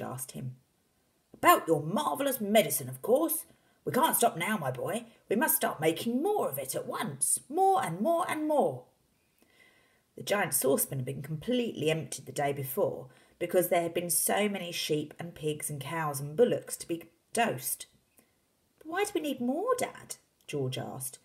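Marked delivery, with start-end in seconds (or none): 1.3-8.8 high, loud, urgent rush of enthusiasm; greatly excited father. A narrator's reading. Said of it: "in a state of greater excitement". 18.8-20.2 lightly voiced boy, pitched high; questioning young son. Son questions his excited father.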